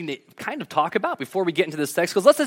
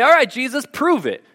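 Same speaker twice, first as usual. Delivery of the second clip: higher, louder taunt; challenging crowd; 8 semitones higher, 5 dB louder than usual. Retold or imitated. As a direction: dare him to prove it. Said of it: "The people say".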